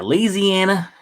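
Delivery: "Louisiana" drawn out slowly as "Leeziana"; local pronunciation. Shown as a demonstration. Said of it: "You gotta pronounce it right".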